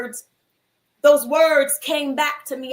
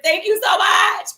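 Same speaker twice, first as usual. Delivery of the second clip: higher, louder, overjoyed; gushing thanks; 7 semitones higher, 6 dB louder than usual. Retold or imitated. Thanking the cab driver, loud and overjoyed. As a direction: thank him like he saved your day.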